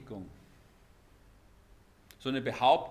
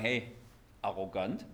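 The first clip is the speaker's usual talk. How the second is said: normal talking voice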